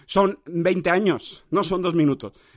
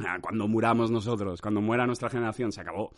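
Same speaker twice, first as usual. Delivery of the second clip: much lower and softer, fast; low pronouncement; 7 semitones lower, 6 dB quieter than usual. Real, not acted. To the mic, on phrases dying out, hushed low. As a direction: drop to a low doom-laden hush.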